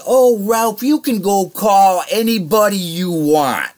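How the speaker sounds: teasing imitation of the boss; mock prissy boss. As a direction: ham up the boss's sneer. Boss voiced in the story.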